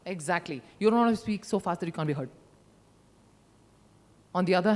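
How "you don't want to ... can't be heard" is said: rushed fast delivery. Shown as a demonstration. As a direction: rattle it off too fast. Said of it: "rate or pace".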